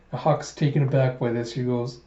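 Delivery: normal talking voice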